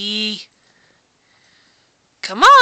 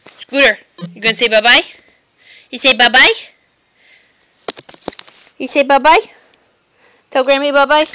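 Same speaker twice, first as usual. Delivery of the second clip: louder singsong coaxing, rising on bye-bye; coaxing pet talk; 7 dB louder, faster than usual. Real, not acted. Owner coaxes dog to say bye.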